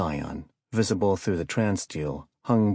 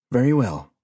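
firm acknowledgment; 4 semitones higher, 6 dB louder than usual. A narrator's reading. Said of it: "Vanoff answered".

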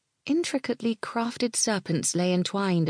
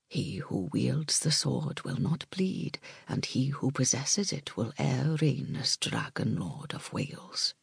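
hushed echo; 3 semitones lower, 5 dB quieter than usual. Acted. Blessing echoed in Malgwyn's mind.

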